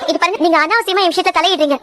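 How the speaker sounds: usual speaking voice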